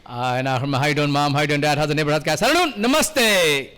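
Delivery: low auctioneer-style patter rising to a bright sign-off; fast-talking caller. Pretend. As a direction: rattle off greetings on a costly call home. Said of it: "you would talk fast, too, if you were paying".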